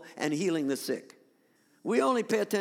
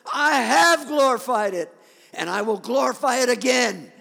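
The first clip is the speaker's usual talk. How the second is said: voice from heaven; 4 semitones higher, 8 dB louder than usual. Acted. God answers Jesus before the crowd, booming thunderous voice. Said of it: "a voice like thunder".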